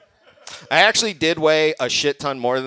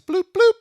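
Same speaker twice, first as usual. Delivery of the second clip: imitated beep; 5 dB louder than usual.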